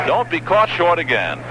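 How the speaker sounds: normal talking voice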